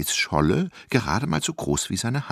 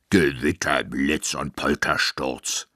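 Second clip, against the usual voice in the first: giant Simon answering; 5 semitones lower, slower than usual. Acted. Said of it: "antwortete er".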